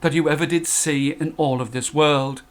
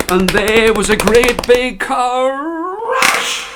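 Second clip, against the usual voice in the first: slow, loud, higher build; booming storybook climax; dramatic crash; 7 semitones higher, 4 dB louder, much slower than usual. A narrator's reading. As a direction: land the crash big and slow.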